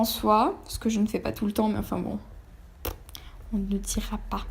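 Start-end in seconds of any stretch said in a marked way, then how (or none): 3.5-4.4 chuchotement complice en aparté; chuchoté. Real, not acted. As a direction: glisser la phrase tout bas, en confidence. Aparté confidentiel à la caméra. (voix chuchotée)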